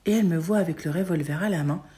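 ordinary delivery, nothing marked

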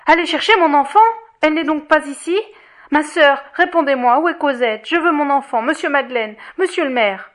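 high, loud, pleading alarm; distraught cry. A narrator's reading. Fantine demands her child from those around her, distraught cry. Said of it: "s'écria-t-elle".

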